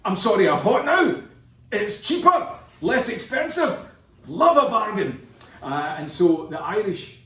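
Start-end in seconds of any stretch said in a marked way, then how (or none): 0.1-5.3 pitched way up and loud, a bargain-hunting Scot; mock Scotsman. Narrator imitating Scots jumping at cheap whisky, high and loud. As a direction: play the thrifty Scot, high and delighted. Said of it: "the Scots are like".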